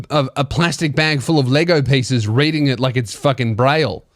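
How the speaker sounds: normal talking voice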